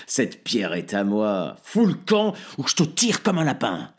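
loud, rising menace; furious threat. Acted. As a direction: threaten to shoot. Threatening with rifle. (le père menace de tirer, crié avec colère)

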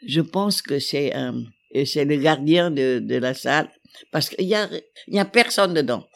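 normal talking voice

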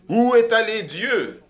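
high, loud anguished cry. A narrator's reading. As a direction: shout the question at the crowd. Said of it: "s'écria-t-il".

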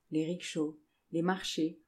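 ordinary delivery, nothing marked

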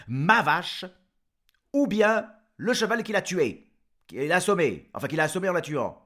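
loud demand. Acted. Jean-Jean exige sa vache.

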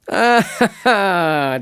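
loud, high "ah ah ah"; hearty, teasing stage laugh; knowing laugh. Acted. Shipowner teasing the young sailor about his sweetheart, knowing laugh.

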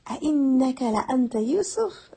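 the brothers finally demand whether it is really Yusuf; emphatic astonished question. Retold or imitated. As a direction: stack the emphasis into full astonishment. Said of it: "emphasis upon emphasis".

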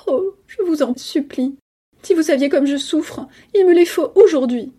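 high plea building to a loud demand; tearful begging. A narrator's reading. Cousin begging for money under a hypnotic order, tearful. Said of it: "Elle pleurait et bégayait".

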